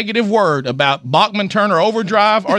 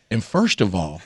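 tuck it into a small voice; little voice; 7 semitones lower than usual. Retold or imitated. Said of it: "With that little voice".

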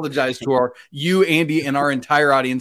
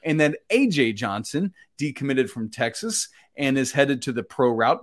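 usual speaking voice

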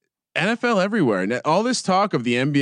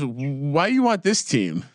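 slow, badly faked Spanish accent; slower than usual. Host quoting a puzzled Mexican shopkeeper, bad Spanish accent. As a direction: imitate her in a clumsy Spanish accent. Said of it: "that was probably a bad Spanish accent".

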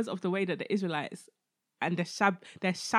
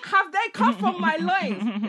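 much higher, louder, slow and indignant; exasperated outburst; 11 semitones higher, 12 dB louder, much slower than usual. Acted. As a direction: Moses throwing up his hands at God. Moses exasperated reading scripture.

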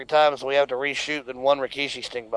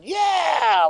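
high yell of yeah; Howard Dean scream; 12 semitones higher than usual. Retold or imitated. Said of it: "like Howard Dean".

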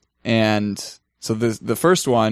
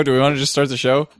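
usual speaking voice

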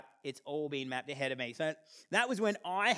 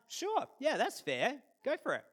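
high, easy-going dad voice; a lightly voiced cheerful dad; dad's breezy yes; 8 semitones higher than usual. Dad giving son permission, high breezy voice.

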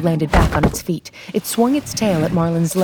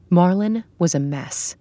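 plain reading voice

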